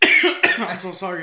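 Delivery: apologizing through a choking cough. Real, not acted. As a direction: splutter out the words mid-choke. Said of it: "I'm choking".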